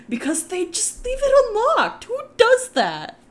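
very high, loud outraged squeal; indignant exclaim. Real, not acted. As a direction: squeal in disbelief. Indignant about unlocked doors.

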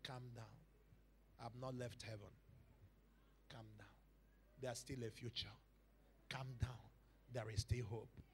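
low, hushed comforting voice; calm reassurance. Retold or imitated. God calming a bewildered believer, very softly. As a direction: soothe softly, leaving long pauses between phrases.

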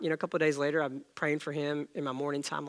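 ordinary talk

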